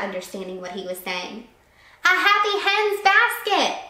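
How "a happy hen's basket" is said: high, loud repeated call; loud high call across the market; repeating a shout. A narrator's reading. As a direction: shout it again so she hears. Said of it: "he repeated".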